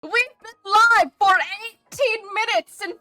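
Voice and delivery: high, loud scandalised squeal; high-pitched outraged exasperation at chat; flustered indignant. Real, not acted. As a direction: scold chat in shocked disbelief. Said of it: "that's still me being smug".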